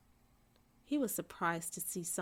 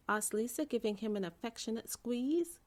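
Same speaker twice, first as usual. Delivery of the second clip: usual speaking voice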